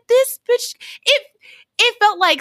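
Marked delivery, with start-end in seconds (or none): 0.1-1.2 outraged. Retold or imitated. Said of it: "I said".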